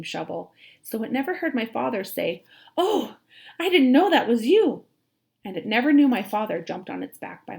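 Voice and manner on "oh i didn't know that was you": higher, louder startled "oh"; surprised apology. A narrator's reading. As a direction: blurt it out, caught off guard. Father apologizes in story.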